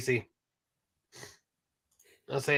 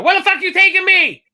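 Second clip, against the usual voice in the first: very high, loud comic outburst; hammed-up alarmed holler; panicked yell; 12 dB louder than usual. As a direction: burst out in mock panic. Acting out panicked passenger in bit.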